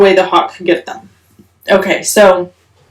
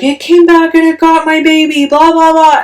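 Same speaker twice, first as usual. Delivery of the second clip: high, upset rush of words; frantic retelling; 9 semitones higher, 4 dB louder than usual. Retold or imitated. Telling her husband the hawk took the baby, frantic. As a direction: blurt it out all worked up. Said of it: "I was lit".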